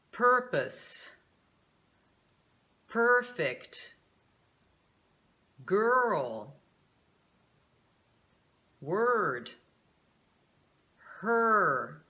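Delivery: put-on the vowel in "purpose, perfect, girl, word, her" as a held r-coloured er; stressed American er. Shown as a demonstration. Teacher listing example words, each with a clear stressed American er. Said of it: "Nessas palavras você vai ver esse fonema".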